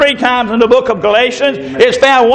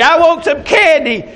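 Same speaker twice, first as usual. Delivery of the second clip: high-pitched imitation of a demanding child; mock tantrum squeal from a small girl; screaming little girl; 8 semitones higher than usual. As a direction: whine it high like a preschooler. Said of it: "the little girl starts screaming".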